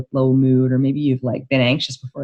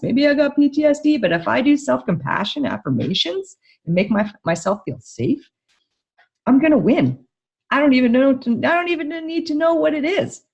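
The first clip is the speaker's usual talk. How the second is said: a pretend inner voice, higher and brighter with growing triumph; hopeful self-talk; 9 semitones higher than usual. Therapist voices a bereaved parent's hopeful thoughts, higher and eager. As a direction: voice the relief of someone who just found the fix.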